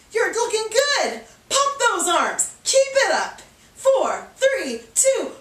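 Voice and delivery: high-pitched, energetic encouragement; fired-up coach's praise and urging; cheering on. Real, not acted. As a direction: cheer them through the last push. Cheering on through the running burst.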